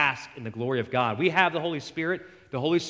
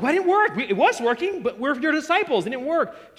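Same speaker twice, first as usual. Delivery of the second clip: much higher, louder whining complaint; whiny disciples; 10 semitones higher, 5 dB louder than usual. Retold or imitated. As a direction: play the baffled, whining disciples. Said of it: "They're like".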